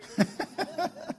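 breathy amused chuckle; light run of chuckles; laugh. Real, not acted. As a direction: chuckle at the memory. Amused by the preacher's sandwich.

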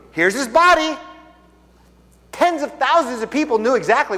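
a pastor pressing his congregation, preaching loud and high; preaching at full force. Real, not acted. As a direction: drive it home like a preacher at the climax. Preaching builds to climax.

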